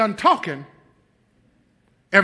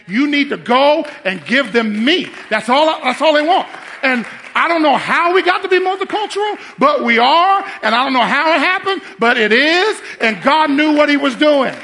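loud, raised, building preacher's delivery; full-force preaching; 6 semitones higher, 8 dB louder than usual. Real, not acted. Pastor tells his church what God said, preaching hard. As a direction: testify with rising force to the congregation.

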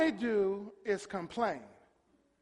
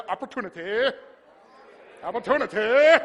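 loud call-out, rising at the end; rallying call; 5 semitones higher, 13 dB louder than usual. Shown as a demonstration. Preacher getting everybody to say it, loud rallying call. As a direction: lead the crowd in saying it, louder the second time. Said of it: "Come on now, everybody say".

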